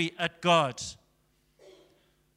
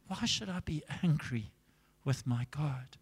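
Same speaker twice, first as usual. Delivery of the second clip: soft, subdued retold question; hushed, gentle puzzlement; weakened voice; 4 semitones lower, 9 dB quieter than usual. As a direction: answer quietly, as a man with little voice left. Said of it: "and God took his voice".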